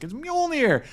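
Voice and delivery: high, thrilled cry of delight; mock excited Thor. Thor overjoyed to see Mjolnir, excited cry. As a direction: gush like a kid seeing his hammer. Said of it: "And he's like".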